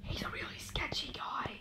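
hushed, unvoiced whisper; whispered aside. Real, not acted. Player confides about the stalker, whispering. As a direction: whisper it behind his back.

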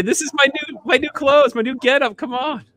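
high mock complaint about new clothes; mock whiny voice. Host jokes about the ruined new shirt, high whiny mock voice. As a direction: whine it like a fussy shopper. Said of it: "So I was like".

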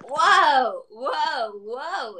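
exclaim, then trail off; excited wow. Real, not acted. Reacting to staying up late.

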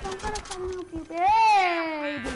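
1 loud call. Real, not acted. Rushing to help the character.

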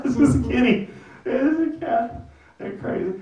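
high nervous laugh through it. Retold or imitated. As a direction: laugh it off to a stranger. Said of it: "trying to laugh it off".

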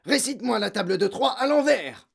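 loud, high barked order; fierce classroom command; barking. A narrator's reading. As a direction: bark it like a tyrant headmistress. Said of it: "aboya mademoiselle Legourdin".

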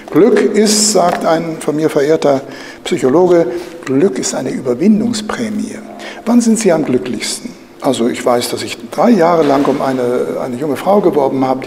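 normal talking voice

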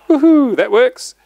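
high, loud, slow woohoo; excited whoop. Real, not acted. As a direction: celebrate it working. Fail-safe works.

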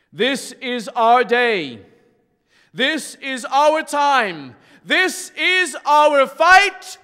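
slow, loud, building proclamation; rallying cry. Real, not acted. Preacher rousing the congregation at the close, rallying cry. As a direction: stretch each word, rising to a shout.

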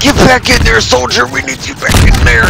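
high, loud drill-sergeant bark; mock commander. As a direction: order the soldier back in. Commanding a game soldier.

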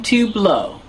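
ordinary delivery

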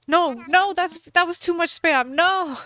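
loud, high cries of no; dismayed shouting. Real, not acted. After spamming buttons too much, loud dismayed cries. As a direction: yelp at overshooting.

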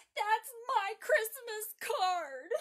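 put-on loud, high, drawn-out child's wail; crying out in protest. A narrator's reading. Margaret sees her card burning, indignant loud wail. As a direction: wail it like a little girl making a fuss. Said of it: "she cried".

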